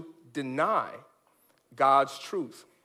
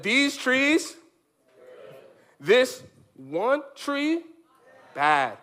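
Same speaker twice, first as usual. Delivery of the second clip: slow, drawn-out declaration; lightly voiced solemn God; God's decree; 4 semitones higher, much slower than usual. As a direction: lay out the rule slowly and simply. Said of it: "God said".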